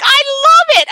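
high-pitched, gushing retold delight; excited squeal of approval; gushing enthusiasm. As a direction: gush like a thrilled fan. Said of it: "And I was like".

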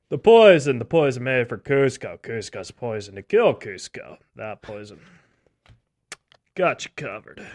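loud booming villain ad voice; Patrick Warburton impression. Shown as a demonstration. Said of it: "we'll have an impression".

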